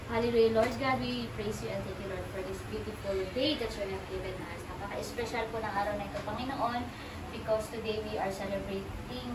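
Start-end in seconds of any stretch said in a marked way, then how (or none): none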